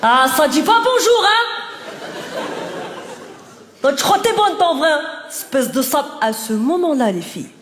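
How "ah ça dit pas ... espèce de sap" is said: harasser taunt. Retold or imitated. Harasser insulting a girl who ignored him, mock taunt. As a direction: needle her like an offended harasser.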